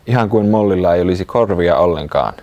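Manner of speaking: ordinary delivery, nothing marked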